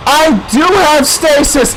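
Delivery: dawning realisation. Real, not acted. Gamer discovers a forgotten power, surprised exclamation. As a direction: exclaim it as it dawns on you. Realises they had stasis.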